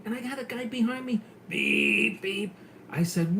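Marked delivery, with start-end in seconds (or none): 1.5-2.5 horn beeps. Retold or imitated. Imitating horn behind him.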